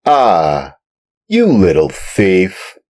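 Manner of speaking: loud, angry farmer's shout. Acted. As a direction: catch the thief and let him have it. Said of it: "said the farmer in an angry voice".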